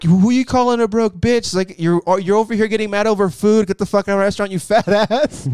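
high-pitched mock outrage; imitating a heated telling-off; retold angry comeback. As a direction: play a manager throwing someone out. Acted quarrel, manager insults customer.

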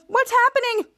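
high-pitched alarmed question; mock panic. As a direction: imitate a startled woman in panic. Frightened woman after her scream.

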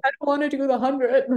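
mock bawling. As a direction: replay the meltdown, hammed-up and tearful. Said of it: "just bawling".

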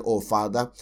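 soft, hushed, low prayer voice; mock soft thankful prayer; gentle quiet prayer. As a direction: pray tenderly and quietly. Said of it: "if I go to a place and say".

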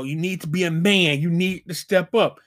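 usual speaking voice

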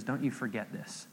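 low, soft, intimate retelling; acted mentor speaking close and softly; hushed earnest charge. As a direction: drop to a quiet, grave charge. Teacher holds boy close.

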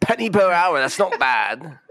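hammed-up chipper Cockney; Cockney chimney sweep. Sweep reckoning his hourly penny, hammed-up Cockney. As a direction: start high in a broad Cockney put-on. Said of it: "Cockney eighteen eighties chimney sweep".

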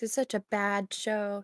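deadpan fake put-down; sarcastic. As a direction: say it flat, then take it back. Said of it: "That's sarcasm".

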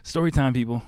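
high, loud call of story time; playful loud kickoff; excited announcement. Real, not acted. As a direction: announce it like a show host.

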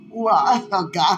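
ordinary talk

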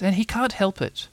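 high little animal voice; piping, earnest character voice; timid Piglet. A narrator's reading. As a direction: plead his case in a small high voice. Piglet defends Tigger.

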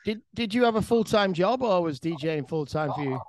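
ordinary delivery

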